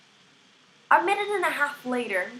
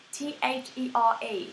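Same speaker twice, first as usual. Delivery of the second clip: normal talking voice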